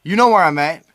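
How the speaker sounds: hammed-up on-air brawl challenge; mock tough-guy challenge. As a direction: dare him to come down. Mock radio feud with caller.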